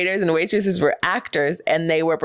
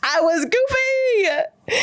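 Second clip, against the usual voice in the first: laughing; much slower than usual. Real, not acted. Laughing at her own teen story.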